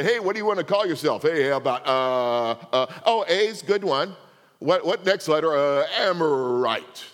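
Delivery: hammed-up naming chat; mock dialogue. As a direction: play a clueless group choosing a name, loud and goofy. Mock naming dialogue among ancients.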